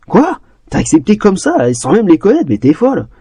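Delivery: very high disbelieving what; shocked. A narrator's reading. Girl shocked her friend joined strangers, disbelieving. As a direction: burst out in disbelief.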